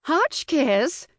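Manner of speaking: high, swooping society-lady call; trilled call. A narrator's reading. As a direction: sing out the name, high and rising at the end. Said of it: "Missus Bashore trilled".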